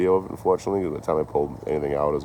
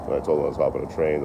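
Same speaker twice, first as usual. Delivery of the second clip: usual speaking voice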